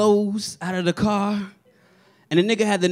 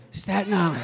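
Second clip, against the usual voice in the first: mock sad mumble; 2 semitones lower, 8 dB quieter than usual. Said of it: "your ass be in the corner like".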